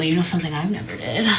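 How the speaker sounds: usual speaking voice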